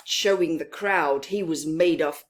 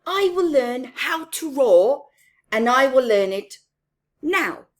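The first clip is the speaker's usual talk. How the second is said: determined vow; 4 semitones higher, 8 dB louder than usual. Acted. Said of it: "thought the mouse".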